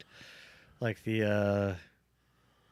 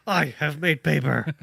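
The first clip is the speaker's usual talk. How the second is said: robot voice; 4 semitones higher, 4 dB louder than usual. Pretend. Robot quote acted.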